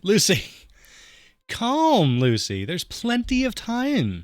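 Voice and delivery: put-on high, teasing kid voice; a hint of a teasing daughter; lightly voiced teen daughter. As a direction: coax mom to calm down, high.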